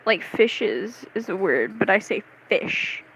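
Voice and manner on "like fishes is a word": ordinary talk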